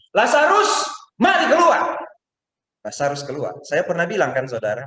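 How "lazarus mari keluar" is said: high loud call from far. Acted. Jesus calling Lazarus from outside, a loud high call. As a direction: call across to the tomb. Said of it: "dia panggil Lazarus dari sana".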